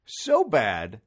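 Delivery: usual speaking voice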